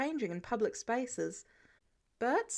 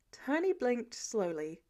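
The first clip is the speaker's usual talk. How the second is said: ordinary delivery, nothing marked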